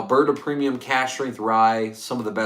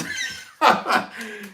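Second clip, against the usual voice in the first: laugh; 9 semitones higher than usual. Real, not acted. Laughing at twenty cents.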